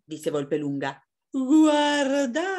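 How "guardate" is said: high, loud storybook call; excited raised-pitch alert. Acted. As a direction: call out to the others to look. Fox alerts the others.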